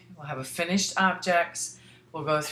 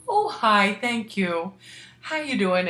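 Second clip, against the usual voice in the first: higher, louder relieved greeting; imitating relieved friend; 5 semitones higher, 5 dB louder, slower than usual. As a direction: greet her with a relieved burst. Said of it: "And she goes".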